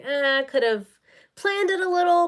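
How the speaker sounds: a light mock of a viewer grumbling; viewer's mild complaint. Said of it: "And then you're like".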